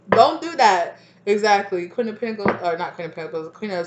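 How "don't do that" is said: much louder, pitch raised; louder warning. Real, not acted. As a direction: punch it out as a firm warning. Repeats warning, second time louder.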